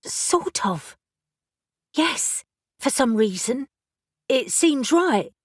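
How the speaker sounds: confessing odd feelings, puzzled; young witch Elle. Acted. Elle character voice.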